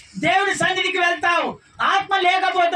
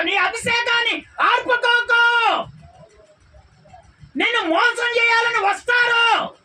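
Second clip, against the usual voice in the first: fiery exhortation; 6 semitones higher than usual. Real, not acted. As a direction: lift the pitch and burn.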